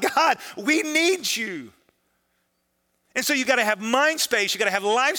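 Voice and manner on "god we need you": high, slow drawn-out plea; acting out a desperate cry to God. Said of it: "two men are crying out".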